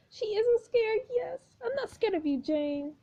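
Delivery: put-on high defiant girl voice; squeaky brave little girl; high girly voice. GM voice for Lily.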